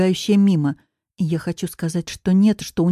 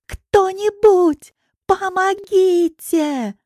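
high, very drawn-out plea; desperate cry; 12 semitones higher, 4 dB louder, much slower than usual. A narrator's reading. Woman pleading for anyone to rescue her, desperate drawn-out cry. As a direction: wail slowly and high for anyone. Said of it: "кричу я отчаянно".

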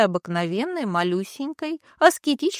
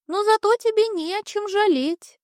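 high, airy spirit voice; high cloud creature; 12 semitones higher than usual. Acted. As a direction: float the words up high like a cloud. Cloud speaks, measured very high.

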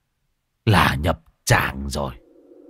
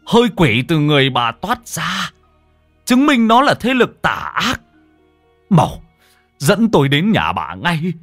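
much higher, loud alarmed cry; urgent alarm; 9 semitones higher, 7 dB louder than usual. Acted. Monk crying out to the wife to hurry home, loud and high. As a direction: shout the warning, the demon is loose.

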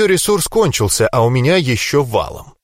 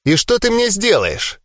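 defiant challenge, pitched high; Тайра; 7 semitones higher than usual. A narrator's reading. Pinned fighter challenges the winner, defiant. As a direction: fling the question back as a dare, lightly growled. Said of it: "с вызовом рыкнула Тайра".

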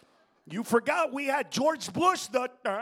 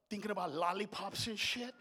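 ordinary talk

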